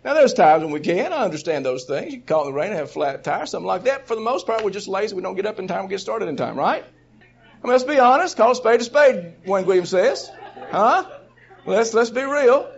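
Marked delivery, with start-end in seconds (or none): none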